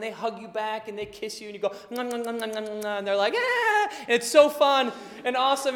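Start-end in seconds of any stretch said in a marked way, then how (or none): 1.9-3.0 higher, playful munching nom noises. Pretend. Parent hugging a small child, playful munching sounds. 3.3-3.9 very high delighted shriek; child's squeal. Retold or imitated. Toddler being hugged by a parent, high squeal.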